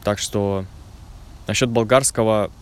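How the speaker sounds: ordinary delivery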